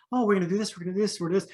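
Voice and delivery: high-pitched mocking politician, tossing out promises; mock Trudeau promises. Critic mimicking the prime minister's promises, light high mock voice. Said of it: "Trudeau tries to paint everything with".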